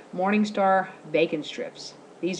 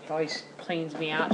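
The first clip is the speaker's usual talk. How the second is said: ordinary talk, nothing marked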